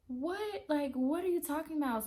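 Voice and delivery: way up high, stunned and disbelieving; high pitched disbelief at the host; incredulous. Retold or imitated. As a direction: squeak out the question in shock. Reacting to police threat.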